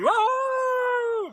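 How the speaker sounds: long, high, sustained mock howl; mad werewolf howl.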